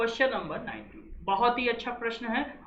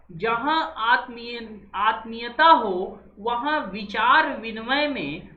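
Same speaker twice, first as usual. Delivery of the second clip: slow, measured reading of आत्मीयता and औपचारिकता, each word laid out; slow, careful classroom reading; slow dictation read; 4 dB louder, slower than usual. Shown as a demonstration. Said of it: "fourteenth क्या कह रहा है".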